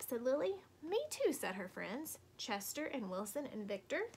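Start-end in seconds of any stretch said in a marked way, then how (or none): 0.8-1.3 eager chorus. Acted. Friends chime in eagerly.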